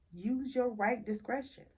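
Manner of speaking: ordinary talk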